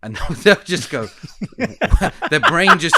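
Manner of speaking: laughing through the words, high and loud. Real, not acted. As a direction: crack up while telling it. Laughing while telling the story.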